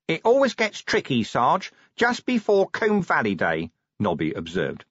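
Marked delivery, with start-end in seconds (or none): 0.1-3.7 high-pitched corporal chatting to his sergeant; chirpy corporal. Acted. Corporal explaining the mood to his sergeant, high voice. Figure of Nobby speaks.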